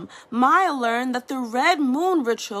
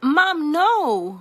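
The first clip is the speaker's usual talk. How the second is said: higher, louder plea shout; 5 semitones higher, 6 dB louder than usual. Acted. Daughter shouting at mother, louder. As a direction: cry out to stop her. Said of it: "Mai shouted".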